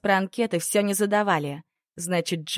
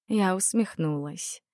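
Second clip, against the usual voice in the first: usual speaking voice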